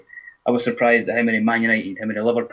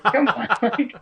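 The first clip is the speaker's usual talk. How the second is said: laughing retort; 13 semitones higher, slower than usual. Retold or imitated. Retelling his reply, laughing.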